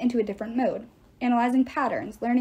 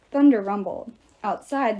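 usual speaking voice

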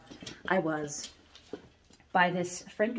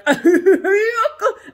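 loud, high burst of laughter; big laugh; 12 semitones higher, 10 dB louder than usual. Real, not acted. Picturing the tubby Frankenstein decor, loud laughter. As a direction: crack up out loud.

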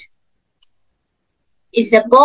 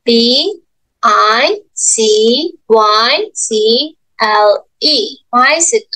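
letters spelled slowly one by one; slow spelling out; much slower than usual. Shown as a demonstration. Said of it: "How do you spell bicycle?".

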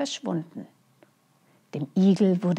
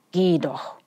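defiant. Acted. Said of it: "antwortete der Igel trotzig".

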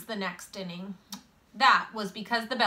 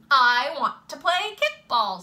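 put-on loud, raised-pitch kid's demand; insistent child; 5 semitones higher, 6 dB louder than usual. A narrator's reading. Boy telling his friend he wants to play, loud and insistent. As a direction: insist loudly like a determined child.

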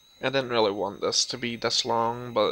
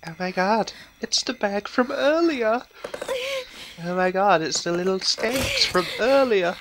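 high loud excited exclaim; excited outburst; 7 semitones higher, 4 dB louder than usual. Real, not acted. Gamer spotting the bullies again, high and loud. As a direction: blurt it out, thrilled and indignant.

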